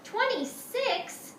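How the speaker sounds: high, drawn-out astonished exclamation; startled teacher, pitch jumping up; exclaiming. Acted. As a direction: exclaim it slowly in disbelief. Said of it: "Missus Hartwell exclaimed".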